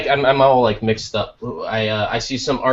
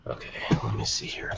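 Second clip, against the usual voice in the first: whispering; 10 dB quieter, faster than usual. Real, not acted. Searching quietly.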